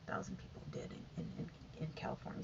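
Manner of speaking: fading into a soft whisper; hushed, trailing off. Real, not acted. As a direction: let the grim number drop to a whisper. Trailing off on grim figure.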